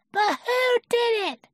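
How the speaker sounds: put-on very high, loud child voice; Roo. A narrator's reading. Little Roo asking Eeyore who did it, very high. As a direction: squeak the question eagerly.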